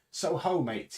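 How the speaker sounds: Long John hails the crew, loud and bluff; hearty hail. A narrator's reading. Long John greeting the crew with a loud, cheery hail. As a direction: call out cheerily to the men. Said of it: "he says".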